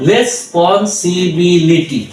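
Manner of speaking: Responsibility stretched very slowly, each syllable separate. Shown as a demonstration. Tutor to learners, slow broken-up responsibility. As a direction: drag out responsibility piece by piece. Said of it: "পুরাটা যদি আমরা উচ্চারণ করতে যাই".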